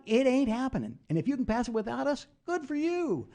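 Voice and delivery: high-pitched mock yell without full volume; retold screaming match. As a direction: re-enact a heated shouting match, pitch pushed way up. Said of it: "screaming at each other".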